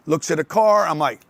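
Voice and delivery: ordinary talk, nothing marked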